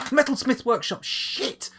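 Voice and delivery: high, loud startled outburst; real jolt of remembering; sudden realisation. Real, not acted. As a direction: blurt it out as it hits you. Suddenly remembers the forgotten game task.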